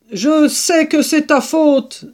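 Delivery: slow, high-pitched angry accusation; furious. Acted. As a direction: throw the blame back furiously. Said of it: "d'un ton furibond".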